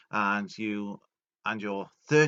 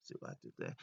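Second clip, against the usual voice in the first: whispered aside to the audience; whispered wink at a joke; sly aside; 12 semitones lower, 16 dB quieter, much faster than usual. Real, not acted. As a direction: murmur the pun under your breath. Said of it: "what we in the biz call the tequila shot".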